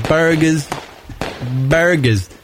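burgers as "BAIR-guhz", dropped R; East Coast accent. Shown as a demonstration. Host showing listeners the regional word, East Coast accent. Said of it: "say it like the East Coast people".

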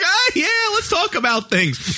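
high, slow eager chime-in. Retold or imitated. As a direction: jump in with slow, high enthusiasm. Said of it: "I just jumped in there like".

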